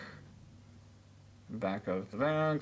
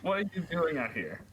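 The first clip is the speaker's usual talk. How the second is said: higher-pitched mock sarcastic girl; lightly acted sarcasm; sarcastic, rhetorical; 5 semitones higher than usual. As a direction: read it as a teasing rhetorical question. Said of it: "shouted Crystal with a sarcastic, rhetorical voice".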